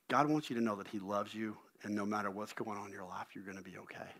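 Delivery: soft, low, comforting; tender reassurance. Retold or imitated. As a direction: gently deliver a message of love. Acted comforting message to stranger.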